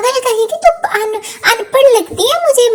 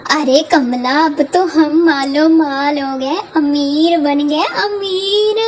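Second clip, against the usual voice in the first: a lower, grumbling sidekick voice; low-voiced partner; 8 semitones lower than usual. Partner nagging Kamla about the screwdriver, lowered voice.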